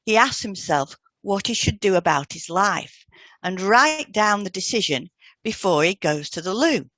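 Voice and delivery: ordinary talk, nothing marked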